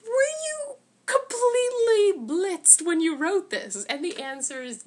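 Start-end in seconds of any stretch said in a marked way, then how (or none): 0.0-3.8 high, loud, slow disbelieving wail; incredulous cry. Retold or imitated. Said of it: "you're like".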